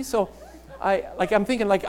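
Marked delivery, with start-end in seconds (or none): none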